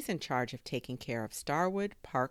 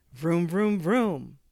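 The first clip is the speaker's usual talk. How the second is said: loud, raised "vroom" engine imitation; race car engine noise; 4 semitones higher, 10 dB louder, much slower than usual. A narrator's reading. Drone flying off like a race car, loud revving. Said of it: "sounded like a race car".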